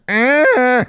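put-on high baby "ah" whine. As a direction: whine like a grabby toddler. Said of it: "She just reaches and goes".

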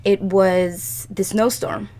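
usual speaking voice

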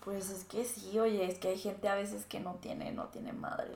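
unvoiced gossiping whisper, barely audible. Real, not acted. Whispering to her co-host about the rude teacher.

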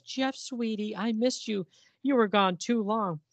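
high, slow, cooing put-on woman's voice; mock girlfriend. Magda greeting Jeff, a high mock cooing voice. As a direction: coo it like a clingy pulp dame. Woman jumps into his arms.

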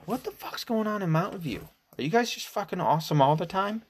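high, amazed outburst; can't believe the numbers; delighted shock; excited disbelief. Real, not acted. Incredulous about Mountain View.

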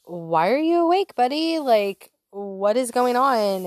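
fake-cheery question with irritation underneath; forced cheerful. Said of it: "very roughly picked Elliot up".

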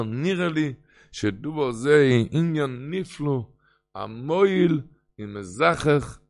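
loud, slow declaimed Hebrew quotation; emphatic text reading. Acted. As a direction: proclaim each phrase forcefully and deliberately. Reads a Hebrew source aloud.

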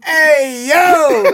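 very high, loud mocking holler; mock shout. Clowning on his friend between laughs, mock high holler. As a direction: yell it in an absurdly high voice.

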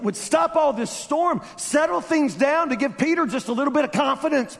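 high, loud preaching voice; fiery sermon at full volume; full-force preaching. Acted. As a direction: drive the point home to the church.

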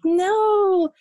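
high, drawn-out reassuring no; childlike protest. Retold or imitated. Daughter retelling her answer to mom, high childlike protest. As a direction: sing it out like a kid reassuring a parent.

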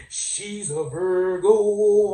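slow, high mock-preacher proclamation. Comic preacher declaring Taraji a Virgo, slow drawn-out call. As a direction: stretch out the last word like a sermon climax. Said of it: "let me get my preacher voice".

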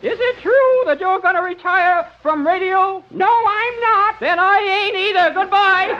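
high goofy caller; silly visitor voice. Acted. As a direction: chirp it like a nervous fan. Door visitor in odd voice.

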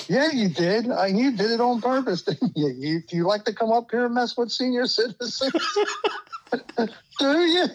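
retold scream. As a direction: reenact shouting down the bench guy through laughter. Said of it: "I screamed at him".

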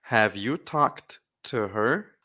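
"Have you talked to her?" slow, rising at the end; slow model reading. Shown as a demonstration. Teacher reading the question slowly for the class to repeat. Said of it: "Mais uma vez".

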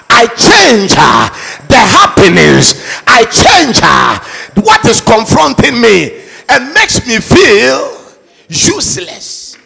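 shouted rallying declarations; booming motivational speech. Acted. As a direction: rouse the crowd at full voice. Leads loud declarations.

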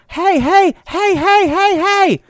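high, insistent repeated calls; mock old lady calling out; imitating yelling lady. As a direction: mimic her waving you over. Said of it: "the lady looks at me and goes".

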